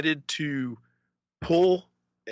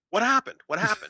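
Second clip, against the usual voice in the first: high, urgent mock questioning; imitated alarmed friend; 8 semitones higher than usual. Retelling friend's reaction to the accident, imitated alarm. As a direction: act out a startled friend asking twice.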